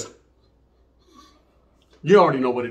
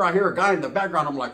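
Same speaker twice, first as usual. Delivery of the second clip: ordinary delivery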